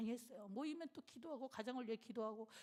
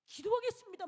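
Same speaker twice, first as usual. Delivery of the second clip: stunned reply; 10 semitones higher, 8 dB louder than usual. Retold or imitated. Pastor's stunned reply on phone.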